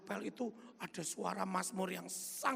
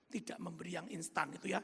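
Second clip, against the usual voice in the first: usual speaking voice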